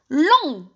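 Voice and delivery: "Long" said loud, ending on the nasal vowel; silent final T. Shown as a demonstration. Teacher giving the second adjective, "Long" with silent T. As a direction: say "Long" as French lent, no T. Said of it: "هاد تي تاني ما رانيش ننطقه".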